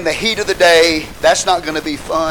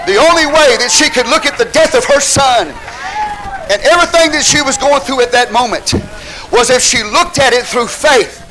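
raised, high-pitched pulpit proclamation; full-force preaching; 5 semitones higher, 4 dB louder than usual. Real, not acted. Preacher proclaiming the woman's trust in God, fervent preaching. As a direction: drive it out loud and high to the congregation.

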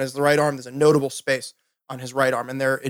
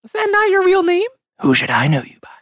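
high character voice. Acted. GM voices dragon.

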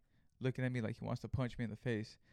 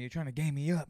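angry accusation; 3 semitones higher, 4 dB louder than usual. Retold or imitated. Customer accuses the clerk of scamming.

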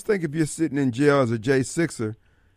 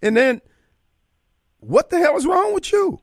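exasperated. Real, not acted. Exasperated rant at other candidates.

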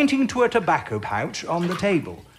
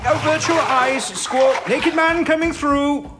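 loud warning; 11 semitones higher, 5 dB louder than usual. Acted. Warning about naked man.

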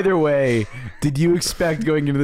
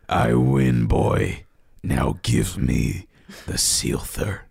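much lower, slow menacing villain; deep villain voice; 13 semitones lower, slower than usual. Acted. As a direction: loom over the beaten boy. Villain Genghis demands the Sealther.

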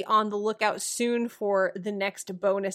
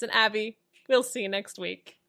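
normal talking voice

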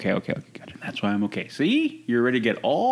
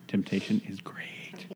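whispered; 3 semitones lower, 9 dB quieter than usual. Shown as a demonstration. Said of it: "repeats in whisper".

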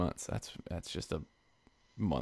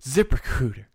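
imitating an ad read; 3 semitones higher than usual. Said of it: "she says".

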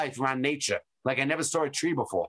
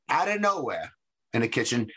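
ordinary talk, nothing marked

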